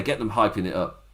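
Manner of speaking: ordinary talk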